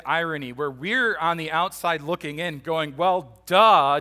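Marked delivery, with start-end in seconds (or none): 3.0-4.0 loud, emphatic "duh"; mock obviousness. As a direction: play an onlooker stating the obvious, punched loud. Mocking the obvious as onlookers.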